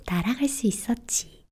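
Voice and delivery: plain reading voice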